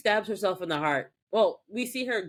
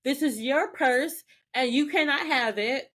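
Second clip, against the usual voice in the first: encouraging Prue; 7 semitones higher than usual. Retold or imitated. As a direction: spell it out firmly. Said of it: "Prue's just like".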